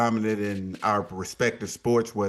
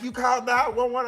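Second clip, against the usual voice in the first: imitating wife; 12 semitones higher than usual. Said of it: "She was like".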